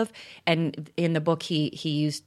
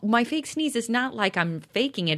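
usual speaking voice

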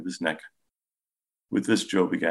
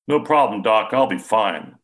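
higher, louder tough-guy brush-off; a proud father insisting he is fine, higher and louder; stoic Joe; 4 semitones higher, 7 dB louder than usual. A narrator's reading. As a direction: shrug it off like nothing hurts.

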